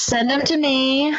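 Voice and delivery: playful, drawn-out come-on; joking invitation. Pretend. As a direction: draw it out as a cheeky invitation. Joking invitation, then retracted.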